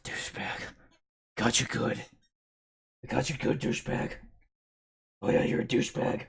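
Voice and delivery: mock someone grumbling under his breath, barely voiced; mumbled under breath. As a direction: imitate a muttered, near-whispered insult. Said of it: "He mumbles under his breath".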